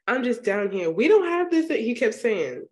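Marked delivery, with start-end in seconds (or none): 0.1-1.7 imitated baffled protest. Voices baffled New Yorker character.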